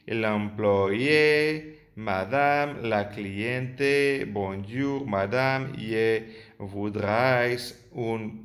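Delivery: every letter pronounced as in Spanish; Spanish-style misreading. Shown as a demonstration. The teacher imitates how students misread the French. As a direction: read the French phrases letter by letter. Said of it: "vuestro cerebro se está leyendo así".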